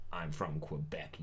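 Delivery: slow, whispered mock hurt; hushed mock reply. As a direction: whisper it slowly as a wounded customer. Acted quiet reply of offended customer.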